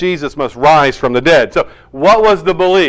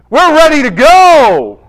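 higher and louder, all keen; eager mock cheer; 11 semitones higher, 7 dB louder than usual. Disciples imagined eagerly believing Mary, bright and loud. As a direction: sell it like the disciples can't wait.

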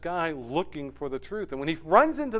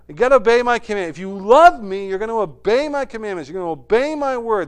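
urgent command; 6 semitones higher, 7 dB louder than usual. Acted. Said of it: "you know".

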